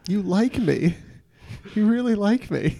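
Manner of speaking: high, gushing Oscar speech; Sally Field impression. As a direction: gush like an overwhelmed winner. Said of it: "The Sally Fields Medal of Dreams".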